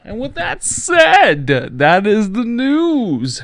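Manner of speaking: very slow, high, loud sign-off; announcer sign-off. Acted. Host closing the news segment, slow loud announcer. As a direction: stretch each word like a closing broadcast.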